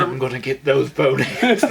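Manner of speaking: a hint of a cartoon villain growl; Disney villain voice. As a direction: threaten like a cartoon bad guy. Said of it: "he sounds like a Disney villain".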